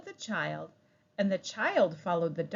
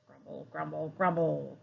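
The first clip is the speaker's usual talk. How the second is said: ordinary delivery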